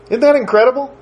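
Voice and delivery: ordinary talk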